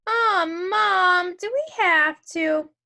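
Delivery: put-on reluctant boy's whine; whining kid.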